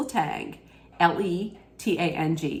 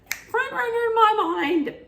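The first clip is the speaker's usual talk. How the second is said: "Front runner in my mind" sung out much higher in a put-on voice; mock falsetto thought. Her first reaction to the bachelor, in a much higher mock falsetto. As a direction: squeal the thought like a smitten fan. Repeats own thought, then in voice.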